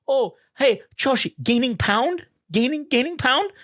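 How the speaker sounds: high-pitched mock Korean accent; imitated broken-English Korean parents. Girlfriend's Korean parents to boyfriend about weight, high mock accent. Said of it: "they didn't speak English that well".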